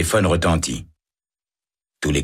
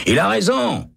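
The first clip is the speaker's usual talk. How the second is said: much higher, called out across cells; shouting prisoner. Acted. Prisoner shouts from his cell, much higher pitched. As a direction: yell agreement down the corridor. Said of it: "crie Hennessy".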